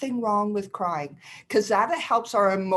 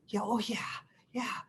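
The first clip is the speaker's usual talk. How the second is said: whispered yes; 3 semitones higher, 8 dB quieter than usual. Acted. Voicing mom's reluctant agreement.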